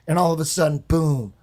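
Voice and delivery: ordinary delivery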